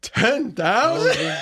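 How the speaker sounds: high, drawn-out incredulous question; retold shock. Ted learns what his dollar bet really means, stunned. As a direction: stretch it out in mock disbelief, pitch way up. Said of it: "And he goes".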